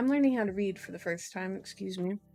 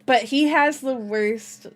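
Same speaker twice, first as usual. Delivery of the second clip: usual speaking voice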